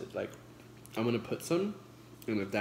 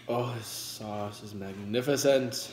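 usual speaking voice

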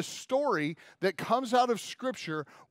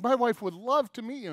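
retold gushing, raised in pitch; happy excitement; 6 semitones higher, 4 dB louder than usual. Telling an Irish guy about his wife, acted eager excitement. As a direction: gush eagerly, acting out the moment. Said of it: "I was so happy about it".

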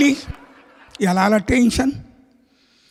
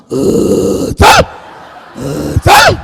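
dog bark; 5 semitones lower, 6 dB louder, much slower than usual. Acted. Dog invites sleepy friend.

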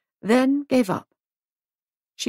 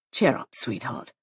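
comforting; 6 semitones lower, 4 dB quieter than usual. Acted. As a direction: lower and softer, reassuring. Giles comforts wife.

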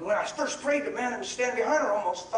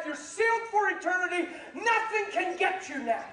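preaching; 8 semitones higher than usual. Real, not acted. Preacher exhorting the congregation, full force. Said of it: "I'm preaching".

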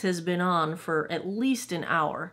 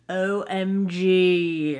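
drawn-out OMG; 6 dB louder, much slower than usual. Real, not acted. Gushing over blush.

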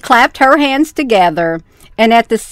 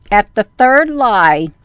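ordinary delivery, nothing marked

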